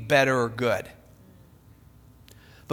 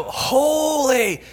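high, drawn-out call; long, raised cry of praise; screaming back and forth; 8 semitones higher, 4 dB louder than usual. Acted. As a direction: call it across to another seraph. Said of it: "they're passing this back and forth".

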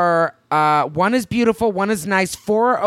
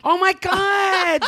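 higher and slow, drawn-out gasp of delight; surprised; 11 semitones higher, much slower than usual. Real, not acted. Host learning from co-host it's episode one hundred, delighted surprise. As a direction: stretch it out in happy shock.

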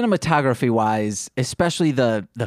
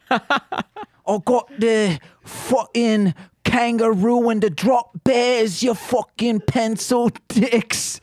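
put-on Australian accent; 11 semitones higher, much slower than usual. Friend demos his Australian accent, shouting high and slow. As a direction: roar it like a rowdy outback larrikin. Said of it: "This is my Australian accent".

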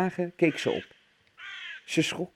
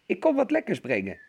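hoog stemmetje; 6 semitones higher than usual. A narrator's reading. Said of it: "jokte de wolf met een hoog stemmetje".

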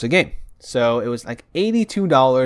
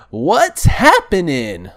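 loud, slow announcer call; 5 semitones higher, 6 dB louder, much slower than usual. Shown as a demonstration. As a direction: belt out the segment title slowly. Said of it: "that was probably very irritating to your ears".

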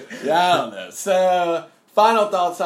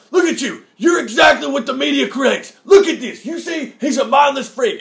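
high, loud hammed-up denunciation; Alex Jones; 9 semitones higher, 8 dB louder than usual. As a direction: shout accusations like a mock ranting host. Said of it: "And he's like".

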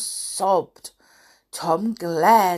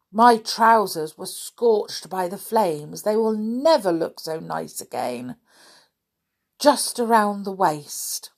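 ordinary delivery

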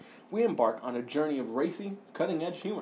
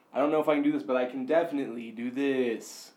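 ordinary talk, nothing marked

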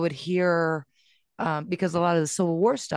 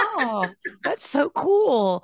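high, slow, laughing enthusiasm; drawn-out playful wonder; mock delight; 8 semitones higher, much slower than usual. Mom humoring her son, high laughing delight. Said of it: "And I was like".

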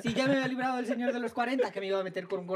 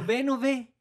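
teasing play-dumb; 4 semitones higher, 7 dB louder, slower than usual. Retold or imitated. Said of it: "Y yo le digo".

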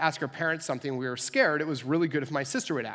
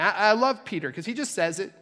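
usual speaking voice

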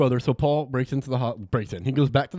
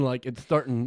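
ordinary delivery

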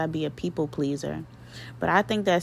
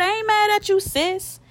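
tender call to sister; 4 dB louder than usual. Real, not acted. Talking to late sister, high pitch.

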